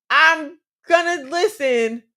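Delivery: usual speaking voice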